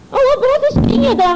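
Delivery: smug hammed-up bragging voice; boastful imitation. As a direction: mimic her showing off, quick and sky-high. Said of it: "他就说".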